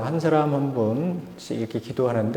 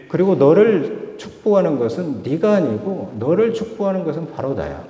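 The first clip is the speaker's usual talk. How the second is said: preaching God's reassurance; 3 semitones higher than usual. Acted. God speaks to worried believers.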